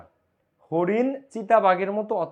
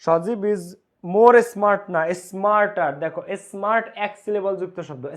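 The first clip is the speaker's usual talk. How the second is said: ordinary delivery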